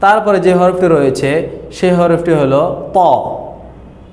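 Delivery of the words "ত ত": "ত" said thick and full, with a rounded o; "ত" with a full mouth, rounded and emphatic; heavy Arabic Ta. Shown as a demonstration. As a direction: isolate each "ত" as a heavy Arabic letter. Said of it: "মোটা করে".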